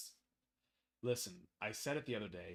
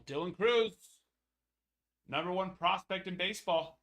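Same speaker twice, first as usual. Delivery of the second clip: excited hype; 5 semitones higher, 10 dB louder than usual. Real, not acted. Hyping top prospect.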